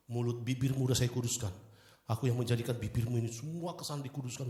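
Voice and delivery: ordinary delivery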